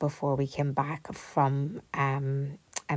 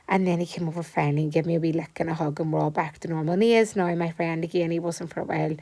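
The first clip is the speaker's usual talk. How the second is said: ordinary talk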